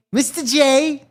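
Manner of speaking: high, drawn-out pet name; Harley Quinn impression. Commentator mimicking Harley's obsession with her boyfriend, lightly cartoonish.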